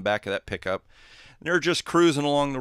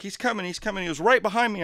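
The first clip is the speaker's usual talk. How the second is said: higher, pleading reassurance; breathless insisting; 8 semitones higher, 3 dB louder, faster than usual. Acted. Half-drowned Aggie assures driver, high pleading. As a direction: insist it between gasps.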